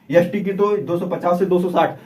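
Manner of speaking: ordinary talk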